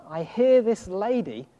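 usual speaking voice

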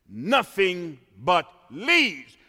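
ordinary talk, nothing marked

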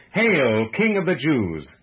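slow, projected acclamation; loud salute. A narrator's reading. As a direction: call it out as a mock salute. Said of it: "began to salute him".

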